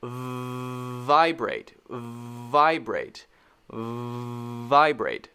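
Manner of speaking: "vibrate" with a long, held vvvv first; long buzzing V. Shown as a demonstration. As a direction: hold the V of "vibrate" as a steady buzz before releasing the word. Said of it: "make your bottom lip vibrate against your teeth".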